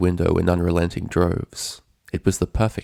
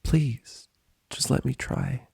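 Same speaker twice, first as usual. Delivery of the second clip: higher, soft pleading; softened plea; 6 semitones higher, 6 dB quieter, slower than usual. A narrator's reading. Twilight softly pleading with Spike after snapping. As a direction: quietly beg him to let her. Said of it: "her tone turned".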